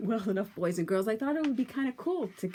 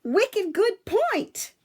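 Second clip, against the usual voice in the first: much higher, slow and louder, a haughty lady's voice; Lady Wendelin; 12 semitones higher, 9 dB louder, much slower than usual. Acted. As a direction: draw it out high like a flouncing lady. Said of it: "Lady Wendelin said, tossing her curls".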